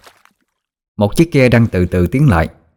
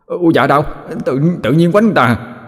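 higher, whining protest; mock whiny yelp; 6 semitones higher than usual. Imp whines higher after a smack on the head. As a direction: complain high like a smacked kid. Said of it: "Thằng chả la bài hải lên".

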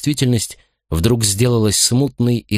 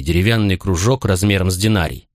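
usual speaking voice

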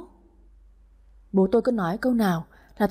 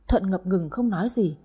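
ordinary delivery

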